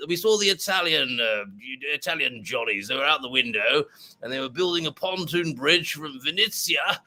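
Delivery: put-on plummy old army officer reminiscing; Colonel Bradbury. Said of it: "It's just what I heard in my head".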